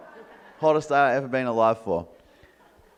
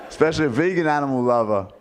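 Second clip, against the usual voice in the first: usual speaking voice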